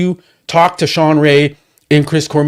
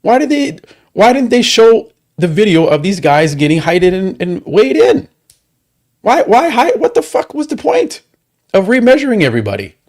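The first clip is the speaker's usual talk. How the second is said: much higher, indignant quoting of a fed-up YouTuber; outraged rant; 6 semitones higher than usual. Retold or imitated. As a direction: throw the angry questions up high, disbelieving. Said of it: "He's like".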